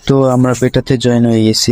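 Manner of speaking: ordinary talk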